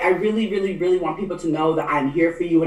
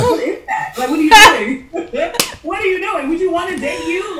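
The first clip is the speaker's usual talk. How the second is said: much higher, laughing mock disbelief; teasing a bland photo through laughter; 10 semitones higher than usual. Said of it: "you're just dry mouth like".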